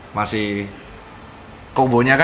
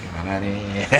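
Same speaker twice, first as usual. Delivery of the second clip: usual speaking voice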